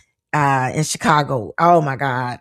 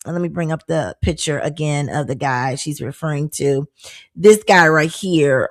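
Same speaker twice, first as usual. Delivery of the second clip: normal talking voice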